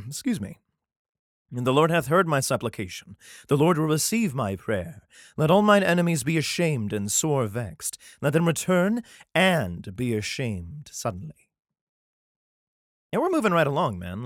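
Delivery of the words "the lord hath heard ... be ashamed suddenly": steady, solemn reading of a psalm; scripture reading. Acted. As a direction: read it like a Bible passage. Reads the psalm aloud.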